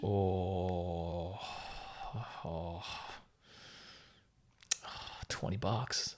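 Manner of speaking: very soft, low, drawn-out hesitation; mock deliberation. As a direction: pretend to agonise quietly over the price. Mock hesitation over sponsor's offer.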